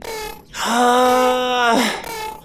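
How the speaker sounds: big defeated groan-sigh; exasperated sigh. Acted. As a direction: sigh like you have lost the argument. Forced to give in.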